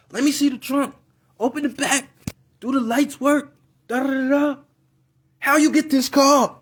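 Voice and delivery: high-pitched imitation of mom; inquisitive mom. Mom inspecting her son's car, high and nosy. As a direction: fire questions in a high mother's voice.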